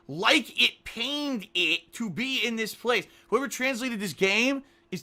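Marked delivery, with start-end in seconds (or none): 0.1-3.1 slow reading aloud. Shown as a demonstration.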